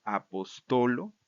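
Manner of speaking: slow "apostolo", stress on the first TO; penultimate stress. Shown as a demonstration. Teacher reciting the dative, slow stress on TO in "apostolo". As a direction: draw out TO in "apostolo". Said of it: "se va a cambiar a la penúltima sílaba".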